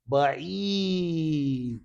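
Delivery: very slow pronunciation for the class; long, held syllable for repetition; drawn-out model word. Shown as a demonstration. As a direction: stretch the vowel right out.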